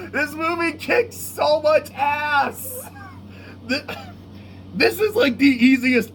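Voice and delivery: high, drawn-out cry of delight; gushing excitement. Real, not acted. Reviewer raving about the movie after laughing, gushing. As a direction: stretch it out, thrilled and high.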